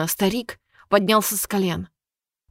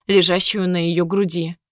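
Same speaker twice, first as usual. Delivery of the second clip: usual speaking voice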